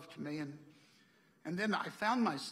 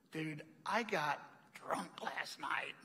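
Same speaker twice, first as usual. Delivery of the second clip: slow, slightly lowered voice, drawn out; hungover confession; 4 dB quieter, much slower than usual. Retold or imitated. Hungover clerk admits the truth to a customer, slow confession. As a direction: confess it slowly, a little subdued.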